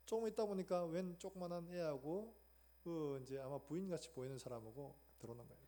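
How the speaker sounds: ordinary delivery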